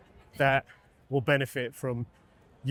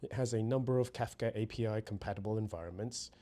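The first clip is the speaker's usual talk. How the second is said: measured, softer tutorial narration; scripted demo voiceover; 5 semitones lower, 9 dB quieter than usual. Acted. Presenter walks viewers through prompting workflows, scripted demo narration. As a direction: read the walkthrough evenly, composed and lower-key.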